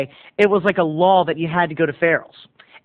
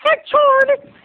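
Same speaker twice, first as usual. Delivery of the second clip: put-on much higher, piping little boy call; shrill-pitched child calling out; high kid voice; 3 dB louder than usual. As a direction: squeak it out like a small boy yelling for friends. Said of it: "Cousin James's voice was still high".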